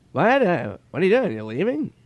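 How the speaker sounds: high-pitched oblivious impression; Norm Macdonald. Comedian strolling in hours late, mock-innocent imitation. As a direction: imitate a bewildered comic, starting loud and high. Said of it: "He goes".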